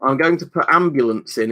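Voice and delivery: ordinary delivery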